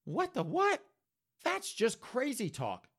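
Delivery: high falsetto disbelief; high put-on voice, scoffing; John's indignant voice. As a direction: squeal it, offended. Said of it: "John's like".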